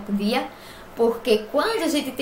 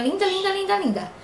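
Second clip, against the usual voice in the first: adoring gush; 9 semitones higher than usual. Real, not acted. Mother gushing over newborn daughter.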